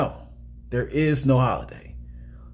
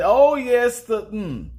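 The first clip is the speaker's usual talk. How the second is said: raised, punched quote, then cut off; Mister Sims famous line; 8 semitones higher, slower than usual. Retold or imitated. Movie fan quotes the host's famous line, playful mock impression. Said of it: "He said".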